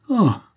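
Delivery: sharp, high, loud cry; distraught cry. A narrator's reading. Concepcion learns where the portrait came from, distraught cry. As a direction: cry out suddenly, overwhelmed. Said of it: "s'écria Concepcion éperdue".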